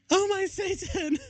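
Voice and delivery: high squealed scream; mock scream. Reader hamming a scream as they leap from the coffin. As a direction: shriek it like a terrified teen. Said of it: "we screamed".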